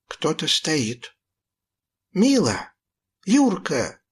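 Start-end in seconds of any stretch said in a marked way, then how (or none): 2.1-4.0 calling out. A narrator's reading. Boy calls into the dark room, hoping for friends, a higher call. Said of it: "окликнул я".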